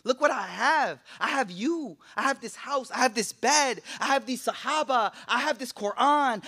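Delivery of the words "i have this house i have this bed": ordinary talk, nothing marked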